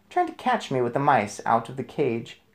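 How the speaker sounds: ordinary delivery, nothing marked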